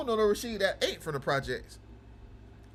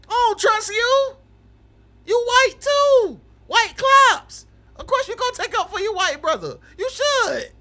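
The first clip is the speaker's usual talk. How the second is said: loud, much higher, squealing accusation; mock outrage yell; 8 semitones higher, 7 dB louder than usual. Real, not acted. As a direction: shriek it in fake betrayal.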